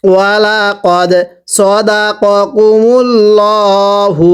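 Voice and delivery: সদাকাকুমুল্লাহু recited very slowly, mim held nasal; nasal ghunnah on mim. Shown as a demonstration. Teacher models the verse for students, slow recitation with ghunnah. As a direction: draw out the মিম in সদাকাকুমুল্লাহু with a long nasal hum. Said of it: "আবার পড়ব".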